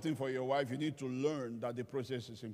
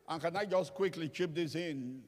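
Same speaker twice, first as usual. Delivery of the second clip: ordinary delivery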